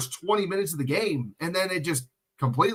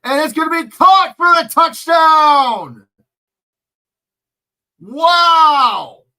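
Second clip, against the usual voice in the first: much higher, much louder excited shout; touchdown call; 9 semitones higher, 9 dB louder, much slower than usual. Real, not acted. Calling the touchdown for viewers, an excited loud shout. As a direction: yell the touchdown, stretching the name.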